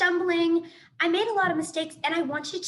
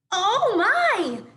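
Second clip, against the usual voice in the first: high, loud gasp of alarm; shocked Oh, my, much louder and higher; startled gasp; 8 semitones higher, 8 dB louder than usual. Acted. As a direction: jump at the sight of her. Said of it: "the opossum gasped".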